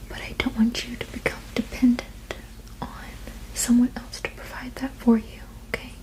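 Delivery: ordinary talk, nothing marked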